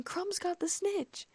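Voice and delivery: very high, drawn-out cheer; delighted scream. A narrator's reading. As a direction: squeal the news without extra volume. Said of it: "I screamed with delight".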